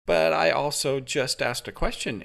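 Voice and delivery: lightly sarcastic retort. Acted. Amy teases the interrogator, lightly sarcastic. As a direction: needle him with mock innocence. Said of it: "Amy said sarcastically".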